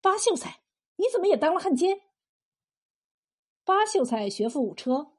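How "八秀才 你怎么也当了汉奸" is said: high-pitched, quickening disbelief; astonished cry. Acted. Captive recognises her acquaintance in traitor's uniform, astonished. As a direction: blurt it out in surprise, without volume. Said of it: "不禁惊讶地喊道".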